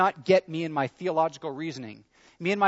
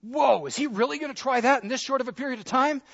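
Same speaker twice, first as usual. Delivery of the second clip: amazed judges; 8 semitones higher than usual. Retold or imitated. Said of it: "they're like".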